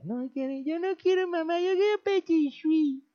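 put-on high, whiny little kid's voice; fussy child. Pastor plays a child telling his mother he wants Petit Suisse, high and whiny. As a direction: whine like a spoiled child refusing his food. Voicing a child refusing food.

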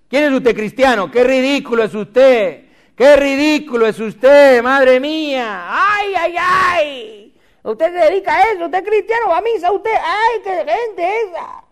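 high, drawn-out mocking outcry; hammed-up ridicule. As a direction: climb into a wailing, scandalised falsetto.

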